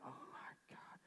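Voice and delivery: under-the-breath whispered oath; grumbling whisper while carrying a heavy load; muttered curse. Retold or imitated. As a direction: hiss it to yourself, annoyed. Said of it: "cursing under their breath".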